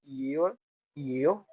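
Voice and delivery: "ये और ये हो" drawn out slowly, the final हो rising; slow teacher drill. Shown as a demonstration. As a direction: spell out the ending slowly for learners. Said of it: "ये आपने याद रखना है".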